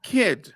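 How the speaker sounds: put-on crisp, aspirated "Kid"; aspirated English K. Shown as a demonstration. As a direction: KID with a puff of breath after the K. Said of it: "le estamos añadiendo el fonema H".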